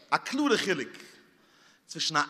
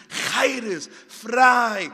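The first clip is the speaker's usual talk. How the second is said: loud, high cry of free; preacher crying out frei; shouted; 3 semitones higher, 6 dB louder than usual. Acted. Shouted word.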